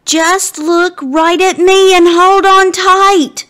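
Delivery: loud high yell. A narrator's reading. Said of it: "Austin yells louder".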